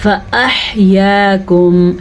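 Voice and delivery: فاحیاکم slowly, with an audible breathy throat "h"; clear throat ha. Shown as a demonstration. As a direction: draw out "yaa" in فاحیاکم. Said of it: "ہا کے مخرج کو ادا کرنے".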